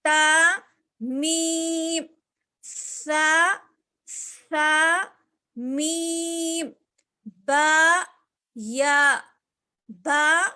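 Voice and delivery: loud letters, with جیم and میم held long; teacher letter drill. Shown as a demonstration. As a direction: call out each letter for the class. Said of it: "نون میں بھی غنہ ہو رہا نا".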